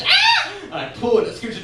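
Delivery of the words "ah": very high imitated shriek; short mock scream. Sister as the raft scooches, screaming. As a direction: yelp a high, brief scream. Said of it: "and she screams".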